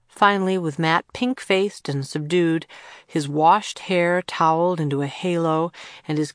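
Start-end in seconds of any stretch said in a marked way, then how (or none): none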